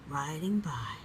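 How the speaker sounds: ordinary delivery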